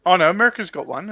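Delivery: normal talking voice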